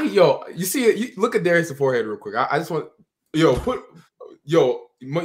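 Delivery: ordinary delivery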